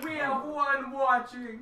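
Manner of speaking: high, loud, drawn-out announcement; excited cheer. Real, not acted. Streamer announcing the first viewer, excited cheer. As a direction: stretch it into a big, celebrating shout.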